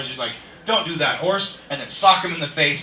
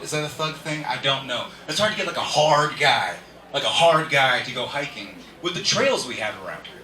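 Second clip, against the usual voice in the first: normal talking voice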